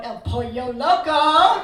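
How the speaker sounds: slow, loud, drawn-out "El Pollo Loco" with laughter; mock the husband stretching "Pollo Loco"; imitating husband's teasing. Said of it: "he kept looking over going".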